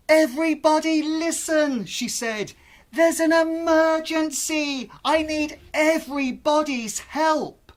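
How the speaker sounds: put-on girl calling out urgently on stage; child announcing loudly. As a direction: announce an emergency to the crowd.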